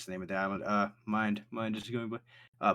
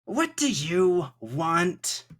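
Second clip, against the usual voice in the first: higher, louder, slow demand; gruff NPC answer; 7 semitones higher, 6 dB louder, much slower than usual. Acted. Caller on the snail line, irritated demand. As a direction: snap it as an annoyed stranger.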